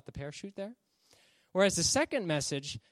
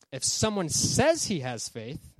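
"says" punched louder and drawn out; stress on "says"; 4 dB louder, slower than usual. Shown as a demonstration. Preacher reading James two to listeners, heavy stress on "says". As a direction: read slowly, hitting "says" hard. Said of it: "I'm gonna read it with enunciation on".

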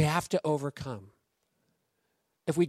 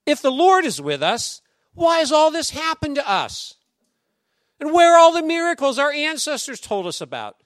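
Gideon's complaint; 8 semitones higher, 5 dB louder than usual. Retold or imitated. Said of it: "Gideon replied".

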